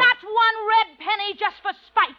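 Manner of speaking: high, heated refusal; angry retort. Acted. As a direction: bite back in anger. Quarrel over debt.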